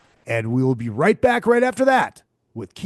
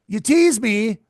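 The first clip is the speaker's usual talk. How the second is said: ordinary talk, nothing marked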